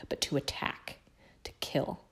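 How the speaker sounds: hushed narration whisper. A narrator's reading. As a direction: breathe it out softly.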